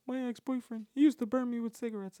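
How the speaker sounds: high, light imitation of the girl; lightly mimicking the girlfriend's story; girl's voice. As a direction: voice her up high and casual. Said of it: "And she was like".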